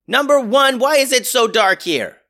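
high, loud captain yell. Acted. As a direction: call out across the bridge. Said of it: "yelled Max".

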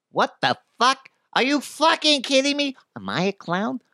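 high, tough Italian-American wiseguy rant; Joe Pesci impression. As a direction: play an offended little mobster, mock-angry. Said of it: "I can't do Joe Pesci".

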